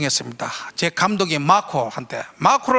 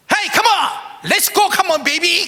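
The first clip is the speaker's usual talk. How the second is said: coach shouting; 9 semitones higher, much slower than usual. Retold or imitated. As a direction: yell encouragement across the field. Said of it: "하면서 얘기했어요".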